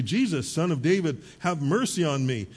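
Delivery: normal talking voice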